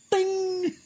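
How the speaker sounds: high little ping, like a phone alert; notification ping. Retold or imitated. Preacher imitates the ping that pulls attention away, high ping. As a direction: chime it out high and bright as a notification.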